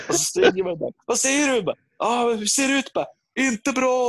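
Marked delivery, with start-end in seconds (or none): 1.0-1.6 high, strained shout up to the roof; mock bellowing across the house; re-enacted yelling. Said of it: "han står och vrålar på taket". 2.0-2.9 high, strained shout up to the roof; mock bellowing across the house; re-enacted yelling. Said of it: "han står och vrålar på taket". 3.4-4.1 high, strained shout up to the roof; mock bellowing across the house; re-enacted yelling. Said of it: "han står och vrålar på taket".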